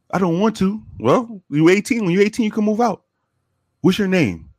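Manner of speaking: put-on high-pitched boyish voice; imitated teen. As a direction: speak up high like the kid. Voicing the teen.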